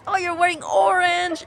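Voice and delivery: high, loud sing-song taunt mimicking a bully; hammed-up playground taunt, high and loud; mocking bully. Bully's taunt, mocked in retelling.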